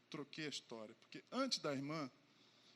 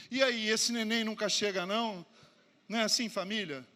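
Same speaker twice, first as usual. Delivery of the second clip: put-on drunk uncle teasing; 4 semitones higher, 9 dB louder than usual.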